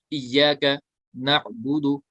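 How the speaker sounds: इय्याका नअबुदु read slowly, no bounce on the आइन; slow recitation. Shown as a demonstration. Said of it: "आइन साकिन है".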